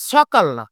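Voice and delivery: সকাল্লা opened with dental s, 'sokal-na'; hissed s where sh belongs in সকাল্লা; wrong s pronunciation. Shown as a demonstration. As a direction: reject the 's' version of সকাল্লা. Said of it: "এটা উচ্চারণ হবে".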